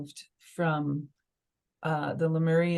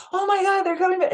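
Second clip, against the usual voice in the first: retold amazement, much higher and louder; excited exclamation; 13 semitones higher, 5 dB louder, faster than usual. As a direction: relive the thrill of seeing them come back to life. Retold joy as bunnies revived.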